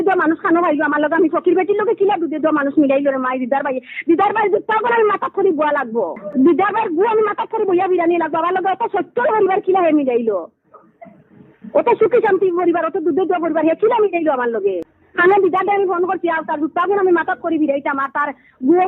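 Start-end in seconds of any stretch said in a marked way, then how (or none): none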